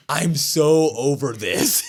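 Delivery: slow, exasperated mock shout; mock fed-up shout. Host imitating Dom fed up with Liv, slow mock shout. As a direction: groan it out like you're totally done.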